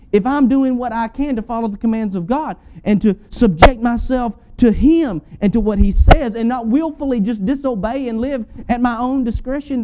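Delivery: ordinary talk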